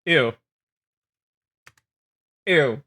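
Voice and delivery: ordinary talk, nothing marked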